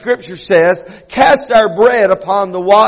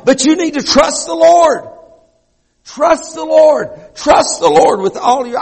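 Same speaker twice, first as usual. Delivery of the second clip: high, ringing preacher call; preaching climax; 8 semitones higher than usual. Real, not acted. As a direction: lift it up and cry it out to the congregation.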